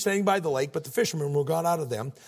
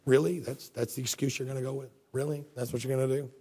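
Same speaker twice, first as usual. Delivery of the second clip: low, softer, unimpressed questioning; glaring disbelief; 6 semitones lower, 3 dB quieter than usual. Acted. Jesus hearing a lame excuse, quietly unimpressed. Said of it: "Jesus ever glare at you like that".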